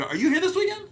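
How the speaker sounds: high, bright mock girl voice; girl at a bar. Girls at a bar asking about his show, mock high voice. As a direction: squeak it like an excited fan. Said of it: "they go".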